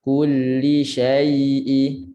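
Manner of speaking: very slow "Kulli syai'i", the "ai" glided; drawn-out Quranic "syai'i"; slow tajwid. Shown as a demonstration. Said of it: "Ini ada sifat lin".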